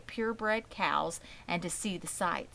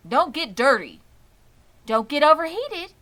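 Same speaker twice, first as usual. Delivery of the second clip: higher and louder, a mother fretting; fussing warnings; 9 semitones higher, 11 dB louder than usual. A narrator's reading. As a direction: rattle off worried rules.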